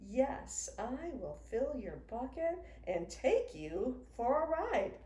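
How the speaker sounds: ordinary delivery, nothing marked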